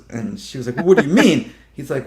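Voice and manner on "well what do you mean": loud, higher-pitched challenge; mock outraged disbelief; indignant protest. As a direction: demand it as if affronted. She protests at the debunking, retold.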